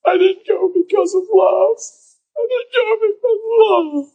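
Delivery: high, tearful confession; bawling. Retold or imitated. Pastor voicing a bawling cashier's confession, high and tearful. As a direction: let the voice break as he admits it. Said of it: "He's bawling".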